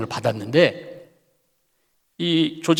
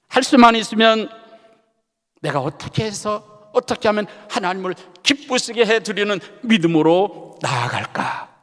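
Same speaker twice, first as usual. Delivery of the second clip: rising sermon exhortation, a little louder; preaching; 2 semitones higher, 4 dB louder than usual. Acted. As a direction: press the promise home to the congregation.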